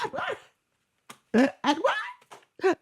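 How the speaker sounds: very high, hammed-up cries of a name; mock desperate Bella wail. Bella calling after Edward, hammed-up wailing. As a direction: wail his name like a melodramatic heroine. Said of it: "she's like".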